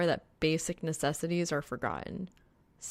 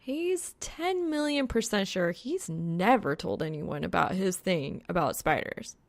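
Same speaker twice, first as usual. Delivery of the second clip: ordinary delivery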